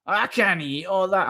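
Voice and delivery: imitating a grandma refusing food; mock picky nana. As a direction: whine it like a fussy old nana. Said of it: "I'm like".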